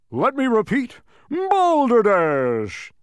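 loud, high bellow, drawn out at the end; booming shout. A narrator's reading. Humbug repeats his interruption, booming shout. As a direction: bellow it and stretch the last word. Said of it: "he shouted again".

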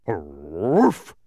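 low gruff barking arf; dog bark. A narrator's reading. Watchdog barking as he dashes to the car. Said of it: "exclaimed the watchdog".

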